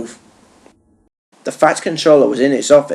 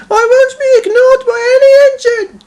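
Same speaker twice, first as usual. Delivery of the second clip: high, loud moaning engine voice; Percy moaning; 6 dB louder than usual. A narrator's reading. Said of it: "he moaned".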